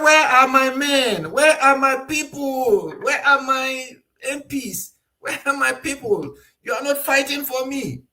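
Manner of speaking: a helpless ruler begging his men to fight for him; frustrated chief. Retold or imitated. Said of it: "He's frustrated because he's helpless".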